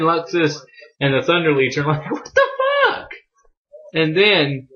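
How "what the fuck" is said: very high squeaked disbelief; hammed-up squeal of shock; mock shock. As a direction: imitate stunned onlookers, voice shooting way up. Onlookers' shock acted out in retelling.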